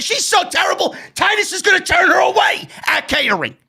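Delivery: high-pitched exasperated outburst; frustrated rant. Real, not acted. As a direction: rant in real disgust at her. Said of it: "Why does J D yell?".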